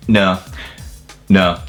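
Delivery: usual speaking voice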